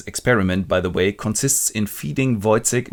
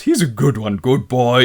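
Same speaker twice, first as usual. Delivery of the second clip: louder praise like to a dog; talking to a dog; 3 semitones higher, 6 dB louder than usual. Retold or imitated. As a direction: pat him verbally like a dog. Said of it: "the captain laughs and goes".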